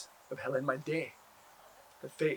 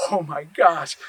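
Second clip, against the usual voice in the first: loud amazed exclaim; 11 dB louder, slower than usual. Acted. Sees earth under fingernails.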